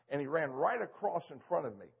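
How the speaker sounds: ordinary talk, nothing marked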